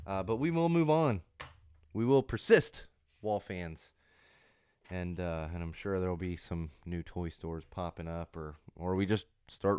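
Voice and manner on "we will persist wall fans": ordinary delivery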